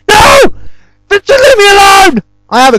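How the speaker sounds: shouting high and loud at the caller; angry shout. Retold or imitated. Shouting at the pushy telemarketer, loud and angry. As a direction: yell no and leave me alone. Furious at repeat telemarketer call.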